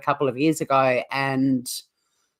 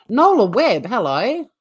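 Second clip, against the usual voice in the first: excited greeting; 7 semitones higher, 5 dB louder than usual. Real, not acted. Greeting a viewer by name.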